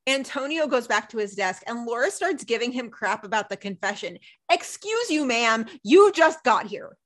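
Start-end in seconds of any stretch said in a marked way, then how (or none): 4.5-7.0 higher, indignant excuse you; sassy indignant retort. Real, not acted. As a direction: put her in her place.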